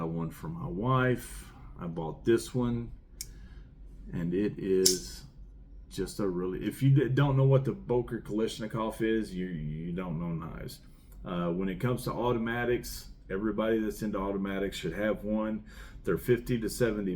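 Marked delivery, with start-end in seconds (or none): none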